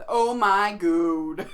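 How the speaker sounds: "God" very loud and stretched, like "goad". Retold or imitated. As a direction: belt out "God" slowly with a long O. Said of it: "force myself to say it with an O".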